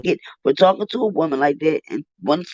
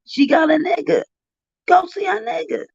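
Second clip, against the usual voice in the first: angry rant; 11 semitones higher than usual. Real, not acted. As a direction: let the anger boil over into a yell. Ranting at a caller on stream.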